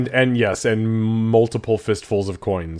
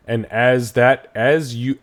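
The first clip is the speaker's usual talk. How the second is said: normal talking voice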